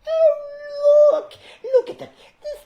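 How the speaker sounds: put-on very high, loud falsetto old lady, laughing; a giddy old woman showing off her gift; Missus Fezziwig. As Missus Fezziwig showing her husband the box, shrill falsetto. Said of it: "and I'm going".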